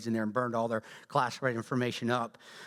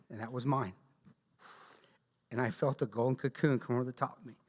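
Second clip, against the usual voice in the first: normal talking voice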